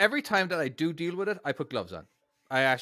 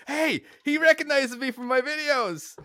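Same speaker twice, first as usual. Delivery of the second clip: excited quote; 11 semitones higher, slower than usual. Retold or imitated. Retold excited thought, high.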